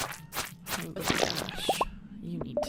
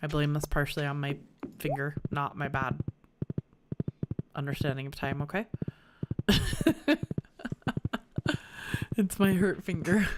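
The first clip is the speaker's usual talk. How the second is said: usual speaking voice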